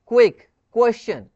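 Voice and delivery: क्विक, क्वेश्चन said loud with a clear kw start; kw sound. Shown as a demonstration. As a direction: punch the क्व at the front. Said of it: "क्व प्रोनाउंसिएट हो रहा है". (क्विक, क्वेश्चन में ज़ोर से क्व)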